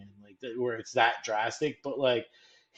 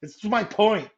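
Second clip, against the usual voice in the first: higher, louder, mock-British; failed British accent; 8 semitones higher, 7 dB louder than usual. Host admits his British accent is bad, hamming up a mock-British voice. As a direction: overdo a British accent on purpose. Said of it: "I shouldn't do a British accent, obviously".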